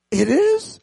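surprised. Retold or imitated. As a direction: sound caught off guard. Surprised at handicapped spot.

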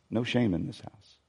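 тихое утешение; soft repeated reassurance. Acted. Repeated softer second time.

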